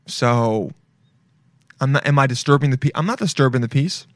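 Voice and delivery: normal talking voice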